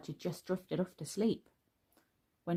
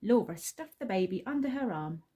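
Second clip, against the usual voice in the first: plain reading voice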